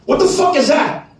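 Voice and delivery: sudden high-pitched complaint; retold exasperated demand. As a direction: demand it like an annoyed client. Wife upset at paint job.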